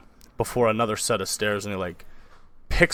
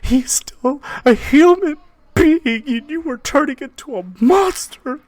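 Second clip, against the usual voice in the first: very high falsetto woman, pleading loudly; hammed-up distressed mother; arguing mother; 10 dB louder than usual. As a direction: protest in a high-pitched wife's voice. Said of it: "your mother and father arguing".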